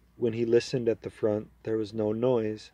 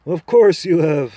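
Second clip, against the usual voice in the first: proud talking horse, higher and louder, persuading; a confident horse making its case to the boy; 6 semitones higher, 11 dB louder than usual. Acted. Horse replies.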